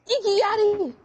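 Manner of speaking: loud call across the room. Real, not acted. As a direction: call the name out. Calling out a name again.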